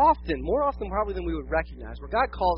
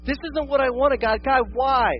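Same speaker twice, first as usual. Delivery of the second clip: high, aggrieved questioning; acted-out exasperated pleading; imitated protest; 5 semitones higher, 4 dB louder than usual. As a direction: complain upward to God, demanding a reason. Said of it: "in the unexpected, in the fear".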